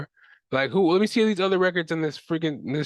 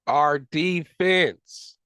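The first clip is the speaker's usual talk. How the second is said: drawn-out exasperation; much slower than usual. Real, not acted. Repeated frustrated restart.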